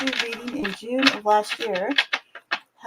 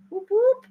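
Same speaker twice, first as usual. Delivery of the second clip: whoop whoop howl; 12 semitones higher than usual. Pretend. Howling at full moon.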